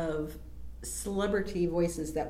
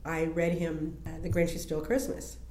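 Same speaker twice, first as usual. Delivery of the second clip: usual speaking voice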